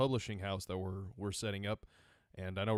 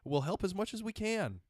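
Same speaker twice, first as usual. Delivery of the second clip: gushing fans; 12 semitones higher than usual. Retold or imitated. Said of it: "everybody's like".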